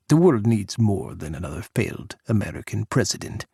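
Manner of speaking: put-on cold Russian-accented farewell; a hint of a disdainful Russian leader; Russian president cutting Jack off, Russian accent. Pukov's accented voice.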